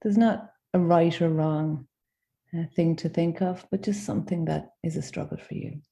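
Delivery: gentle guided meditation with long pauses; unhurried invitations, each left to settle in silence. Acted. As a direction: guide them inward, phrase by phrase.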